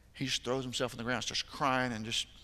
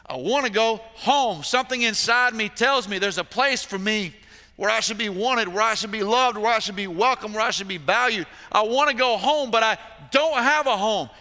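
higher, louder pleading cry; impassioned pleading on their behalf; voicing the cry of the heart; 9 semitones higher, 11 dB louder than usual. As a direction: cry out like the lost boy, longing. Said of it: "just like that little boy".

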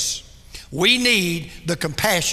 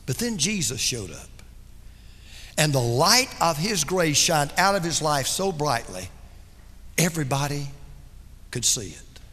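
tender retelling; 6 semitones lower, 4 dB quieter than usual. Acted. Voicing John softly.

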